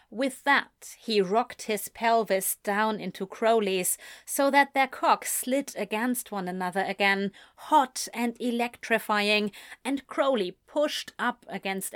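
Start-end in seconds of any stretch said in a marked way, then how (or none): none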